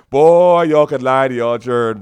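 bursts out loud, laughing at the comment; loud laughing outburst. Real, not acted. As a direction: laugh and shout it out.

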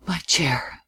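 whispered softly to himself. A narrator's reading. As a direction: breathe it almost inaudibly, to yourself. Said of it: "as if to himself".

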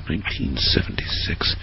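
near-whispered, trailing musing; hushed, low thinking aloud; musing to oneself. Real, not acted. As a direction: softly repeat it to yourself while searching memory. Thinking aloud, searching memory for date.

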